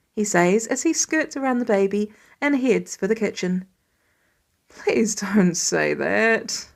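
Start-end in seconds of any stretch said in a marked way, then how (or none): 4.7-6.7 slow, drawn-out groan of dread. A narrator's reading. Tony groaning to Peter, slow and weary. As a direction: drag it out like a weary dad. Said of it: "Tony groans".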